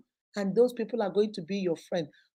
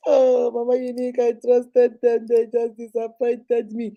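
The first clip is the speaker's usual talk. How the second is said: mock lament; 5 dB louder than usual. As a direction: act out a wounded complaint, stretched out. Acting out a let-down complainer.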